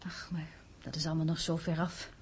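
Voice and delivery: soft whispered aside; whispered to herself. Acted. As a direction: brush it off under her breath. Mother dismisses future softly.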